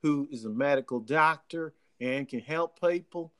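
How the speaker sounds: imitated Southern country accent; demonstrating a drawling country accent; country twang. Shown as a demonstration. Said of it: "someone like this who's got this kind of twang".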